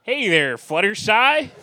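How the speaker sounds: sing-song Southern twang for Applejack; hammed-up country-girl Southern accent. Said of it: "your best southern accent".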